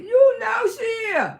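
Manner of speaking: high, slow, loud insistence; emphatic shout. Real, not acted. To her viewers about Tiffany's mama, a loud insisting shout. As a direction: belt out each word to prove the point.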